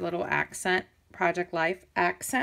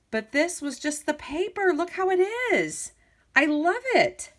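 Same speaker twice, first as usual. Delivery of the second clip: high, excited gushing over the paper; delighted; 11 semitones higher than usual. Real, not acted. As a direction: squeal with delight at the glitter. Showing off glittery paper.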